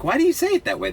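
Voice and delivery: high-pitched puzzled asking; puzzled question. Retold or imitated. Asking Dominicans about their dialect, high and puzzled.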